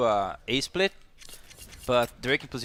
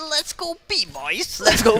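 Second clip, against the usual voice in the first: mock shout; 9 semitones higher, 7 dB louder, slower than usual. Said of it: "Seems like".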